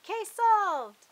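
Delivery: loud, high cheer; celebratory shout. A narrator's reading. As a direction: shout the win triumphantly. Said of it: "the team celebrated and shouted".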